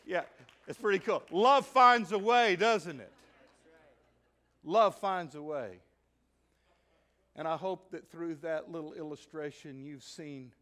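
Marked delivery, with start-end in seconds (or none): 1.3-2.5 loud, slow proclamation; proclaiming. Acted. Preacher proclaiming love to the congregation. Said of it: "refrain repeated". 4.6-5.8 loud, slow proclamation; proclaiming. Acted. Preacher proclaiming love to the congregation. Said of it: "refrain repeated".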